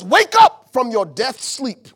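slow, loud command to wake; loud slow call to wake; loud slow proclamation. Acted. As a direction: boom it out slowly. Preacher quoting God, loud.

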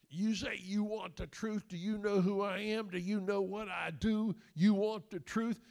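hammed-up movie colonel taunt; Jack Nicholson impression. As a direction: lean in slow like a colonel daring the lawyer. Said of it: "And Jack Nicholson goes".

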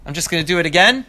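ordinary delivery, nothing marked

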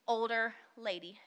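usual speaking voice

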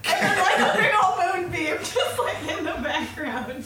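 high, loud, talking through laughter; real laughter. Real, not acted. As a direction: crack up while picturing the scene. Laughing while picturing the silly scene.

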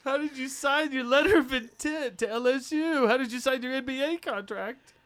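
high, mocking disbelief; teasing incredulity. Teasing follow-up questions.

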